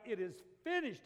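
loud, high proclamation; preacher acting out a triumphant yell. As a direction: shout it out with full strength. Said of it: "when he yells out".

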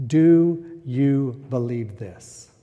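very slow, hushed, each word apart; quiet searching question. Shown as a demonstration. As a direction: lean in and ask it softly. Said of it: "the most important question".

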